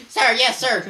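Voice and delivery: loud high sir yes sir; recruit reply. Pretend. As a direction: answer like an eager cadet. Baby answers the officer.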